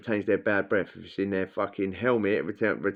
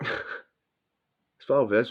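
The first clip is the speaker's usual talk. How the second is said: ordinary talk, nothing marked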